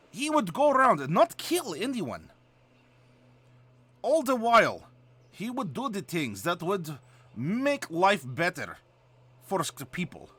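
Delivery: thick Russian accent from the throat; throaty Russian storyteller. Shown as a demonstration. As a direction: roll the R's like a Russian. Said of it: "deep Russian accent".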